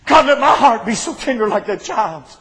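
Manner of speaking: high, loud shouted preaching; full-force preaching. Real, not acted. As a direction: preach it out with everything you have.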